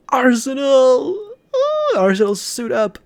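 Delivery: high, loud dramatic cry; high heroic shout, drawn out; summoning call. Acted. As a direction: call out your weapon's name. Hero summons his weapon.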